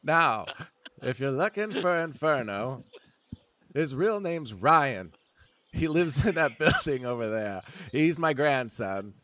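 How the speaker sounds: put-on a kindly old geezer at the pump; elderly man voice.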